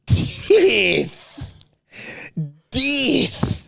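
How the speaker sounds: high, drawn-out mimicry of Kiwi "Death"; mock Kiwi accent. Co-host mimics host's word, high mock Kiwi accent. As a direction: parrot a Kiwi "Death" in a squeal. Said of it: "You just said the word death and you went".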